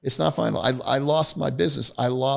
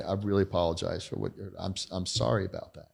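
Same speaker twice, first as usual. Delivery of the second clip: quiet, humble apology; sincere apology; 5 semitones lower, 8 dB quieter than usual. Retold or imitated. Apologising to the office assistants, quiet and humble. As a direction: say sorry softly and meaningfully. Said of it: "go to the ladies and say".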